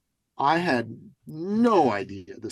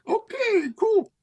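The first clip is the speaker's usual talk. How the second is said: high, startled okay cool; mock surprised okay; 4 dB louder, slower than usual. Host startled by Julie jumping on stage, high mock surprise. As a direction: caught off guard, squeak it out. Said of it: "And I'm like".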